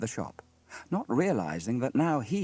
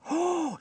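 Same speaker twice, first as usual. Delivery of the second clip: high, voiced gasp; scandalised gasp. A narrator's reading. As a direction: gasp like a shocked gossip. Busybody shocked by what she sees.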